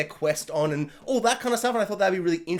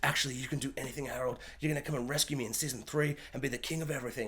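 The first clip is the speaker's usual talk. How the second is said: much softer, fast lady's voice; 6 semitones lower, 12 dB quieter, faster than usual. Retold or imitated. The lady reassures Harold, soft and fast. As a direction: hurry it softly like a promising princess. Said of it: "she's like".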